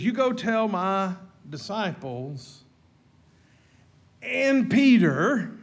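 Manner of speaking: slow, building to a loud ringing "and Peter"; preacher hammering home "and Peter"; emphatic slow command. Acted. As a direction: lean hard on "and Peter", slow and rising. Preacher voices Jesus' command.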